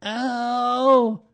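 high, loud small-animal ow; loud high cry of ow; cried-out "ow". A narrator's reading. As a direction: yelp ow in protest, up high. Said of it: "cried Piglet".

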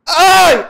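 loud, high "oh" moan; exaggerated high-pitched moan; mock moan. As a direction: burst out with a put-on moan. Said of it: "do you want me to moan".

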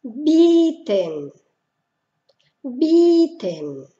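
Bieten with a long I, E silent. Shown as a demonstration. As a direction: draw out the I in Bieten. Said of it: "se pronuncia como una I larga". (Bieten con una I larga)

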